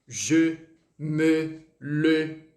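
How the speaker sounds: teacher drilling Je, me, le; rounded French e. Shown as a demonstration. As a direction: round the lips on each e. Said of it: "principalmente com o e".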